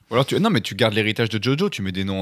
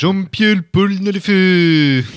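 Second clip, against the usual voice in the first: put-on high, loud, slow Japanese accent; 9 semitones higher, 7 dB louder, much slower than usual. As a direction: belt the name out like an anime attack in a Japanese accent. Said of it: "avec un accent japonais".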